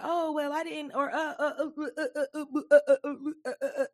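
high, stuttering mimicry of excuse-makers; mock stammered excuses. Host mimicking helpers who didn't follow instructions, mock stammering. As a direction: stammer uselessly in a high mocking voice.

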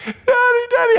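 loud, very high mock wail; hysterical child. Lost girl still bawling for her father, hysterical. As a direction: cry out like a frantic little girl.

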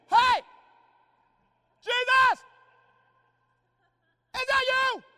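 high, loud yell across the water; shouting Peter. Acted. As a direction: call out long and loud. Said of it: "Peter speaks up".

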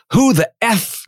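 high-pitched imitation of a cursing man; mock angry yell. Angry guest curses out the MC, imitated yelling. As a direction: act out an indignant outburst without full volume. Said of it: "he starts yelling at me".

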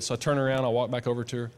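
ordinary delivery

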